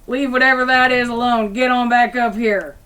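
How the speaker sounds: a camp man hollering at his hound; yelling at the dog. Acted. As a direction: bellow the orders down at him. GM voices camp man yelling at hellhound.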